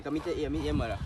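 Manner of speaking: ordinary delivery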